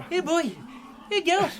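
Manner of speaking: high coaxing dog call. Acted. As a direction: lure the dogs in, high. Coaxing the dogs over.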